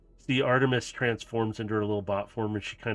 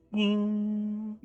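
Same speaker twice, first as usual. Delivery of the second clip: high, sustained metallic chime imitation; ringing ding; 11 semitones higher than usual. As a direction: sing out a long high ding for the blade. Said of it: "and you hear that".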